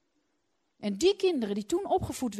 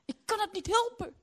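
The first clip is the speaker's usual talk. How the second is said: high, loud protest; sick wife loudly protesting to her husband; defensive protest; 9 semitones higher, 7 dB louder than usual. Retold or imitated. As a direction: blurt it out defensively. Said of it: "Ik zeg".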